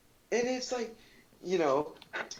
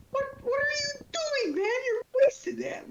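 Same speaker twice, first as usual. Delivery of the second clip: shrill high-pitched complaining, half crying; high whiny voice. Retold or imitated. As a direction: whine it out like a crying kid. Scolding classmates, retold.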